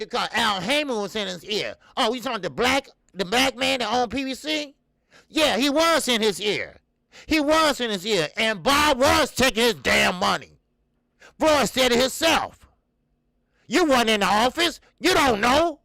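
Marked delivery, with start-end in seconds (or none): 5.3-15.8 heated, loud, accusing; angry. Real, not acted. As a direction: raise your voice at him in anger. Heated rant about boxing promoter.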